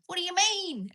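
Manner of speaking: high, loud inland Aussie outburst; rural Australian accent. Retold or imitated. Actress protesting about a dialect coach, in imitated rural Australian. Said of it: "this inland, rural Australian dialect".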